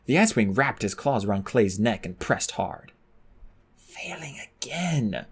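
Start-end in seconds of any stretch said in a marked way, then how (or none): 3.8-5.3 slow, soft despairing whisper; hopeless whisper. A narrator's reading. Clay losing the fight, hopeless whisper. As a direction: fade out, defeated. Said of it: "Clay thought hopelessly".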